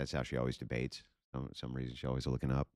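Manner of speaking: ordinary talk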